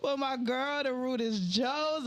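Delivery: thrilled, stretched-out plug for next week; excited. Real, not acted. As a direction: burst out high with excitement, then settle. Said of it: "I'm so excited".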